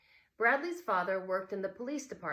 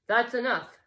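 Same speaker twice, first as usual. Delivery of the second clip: much louder stern cutoff; father snapping louder at the table; 5 semitones higher, 12 dB louder than usual. Acted. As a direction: shut the kids down as father. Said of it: "said their father".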